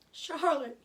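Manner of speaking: loud, high wail of grief; crying out his friend's name; anguished moan. A narrator's reading. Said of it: "he moaned".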